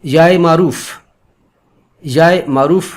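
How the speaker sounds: usual speaking voice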